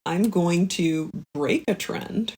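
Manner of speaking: ordinary talk, nothing marked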